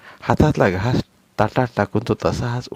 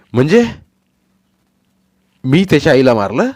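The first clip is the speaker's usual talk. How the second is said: indignant retort; 6 semitones higher, 9 dB louder than usual. Acted. Husband quarrels back.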